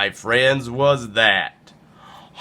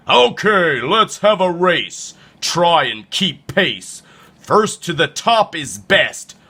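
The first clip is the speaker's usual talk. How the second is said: raised-pitch, pushy challenger voice; higher, bossy storybook villain; scheming llama bully; 6 semitones higher than usual. Acted. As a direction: issue the challenge as a cocky bully.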